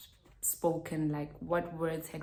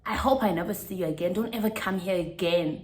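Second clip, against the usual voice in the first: retold angry warning; 2 semitones higher, 6 dB louder, faster than usual. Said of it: "he continues to say".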